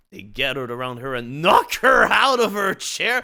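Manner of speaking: ordinary delivery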